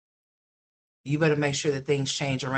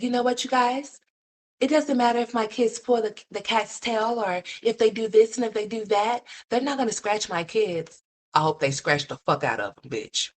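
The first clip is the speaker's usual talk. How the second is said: higher mocking quote of the mother; mocking mom; 6 semitones higher than usual. Viewer mimicking the mother brushing off the cats, higher. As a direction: mimic her careless tone.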